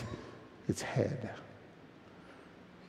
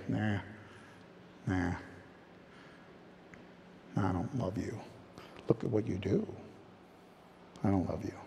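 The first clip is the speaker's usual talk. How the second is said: quiet dismissive voice; 7 semitones lower, 11 dB quieter than usual. Retold or imitated. Voicing cold uncaring believer.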